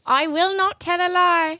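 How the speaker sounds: high, slow British recitation; drawn-out prim British accent; Professor Umbridge. Acted. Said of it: "Sounds like a real Professor Umbridge".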